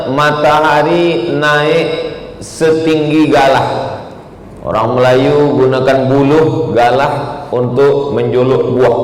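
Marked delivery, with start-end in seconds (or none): 0.1-4.0 slow, stretched explanation of the meaning; slow, stretched teaching voice; drawn-out meaning. Shown as a demonstration. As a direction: draw each word out, slowly, like a lesson.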